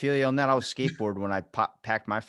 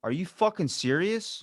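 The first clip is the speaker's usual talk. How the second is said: shocked disbelief; 9 semitones higher than usual. Real, not acted. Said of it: "reacts to dog split in half".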